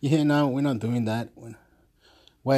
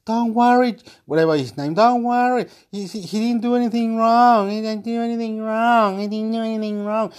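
lady defending; 10 semitones higher, 3 dB louder than usual. Retold or imitated. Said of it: "the lady's going".

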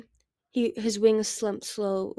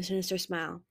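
ordinary delivery

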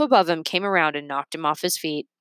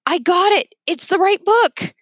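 high, excited yell down from the ladder; 12 semitones higher, 5 dB louder than usual. A narrator's reading. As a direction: shout the find up high. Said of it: "Leo yelled down".

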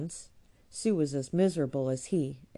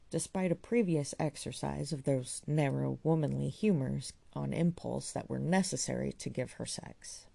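ordinary delivery, nothing marked

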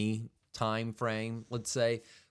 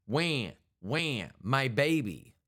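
badly faked child's wailing, word by word; poor child-actor impersonation; 2 semitones higher, slower than usual. Girl pretending to cry to distract someone, badly faked wailing. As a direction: fake a baby's cry using spelled-out wails. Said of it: "doing a poor impersonation of a child actor".

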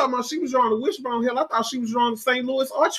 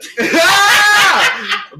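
loud laugh; 9 semitones higher, 6 dB louder than usual. Real, not acted.

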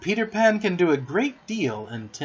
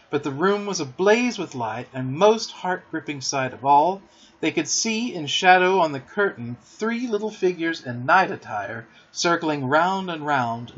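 ordinary delivery

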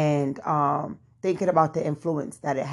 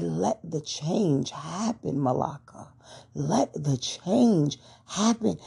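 slow, hushed inner voice; slower than usual. Retold or imitated. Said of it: "something in me just said".

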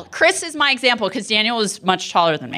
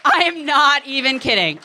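normal talking voice

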